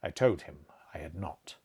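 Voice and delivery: usual speaking voice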